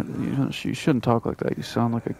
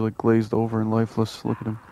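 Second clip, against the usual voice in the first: normal talking voice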